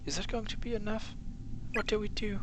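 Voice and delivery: frightened schoolgirl. Acted. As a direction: voice a scared girl in the dark. Girl character voiced by reader.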